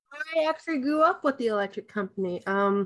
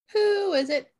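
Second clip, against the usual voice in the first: high squawky parrot asking who is it; 8 semitones higher than usual. Retold or imitated. Parrot calls through the door, high. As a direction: play the parrot behind the door, pitched up. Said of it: "The bird goes".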